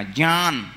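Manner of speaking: ज्ञान as "jnyaan", sounded through the nose; nasal pronunciation. Shown as a demonstration. Teacher shows the class ज्ञान with a nasal sound. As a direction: let ज्ञान ring in the nose. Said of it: "नाक के स्वर से बोला जाएगा".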